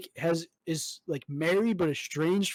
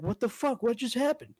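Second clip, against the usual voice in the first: higher, baffled mock outcry; retold shock; 7 semitones higher than usual. To his friend, retelling a dream, a higher baffled outcry. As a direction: act out the shock at the clothes in the pool.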